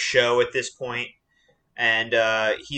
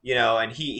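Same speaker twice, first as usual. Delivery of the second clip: ordinary delivery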